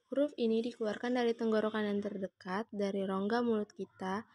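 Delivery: ordinary delivery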